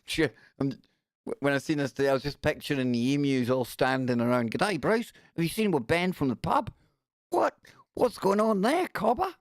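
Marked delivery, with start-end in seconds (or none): 4.5-9.3 high-pitched comic Aussie emu banter; Australian emu characters. Acted. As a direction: play puzzled Australian emus, pitched up. Acts out emus chatting as Australians.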